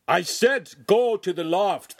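loud, high, slow command; angry scream. A narrator's reading. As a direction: scream the order out word by word. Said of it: "the master screamed".

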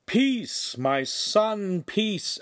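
firm calming command, pitched high; stern priest holding back the master. A narrator's reading. Priest sternly restraining the angry master. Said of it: "Aramis said sternly".

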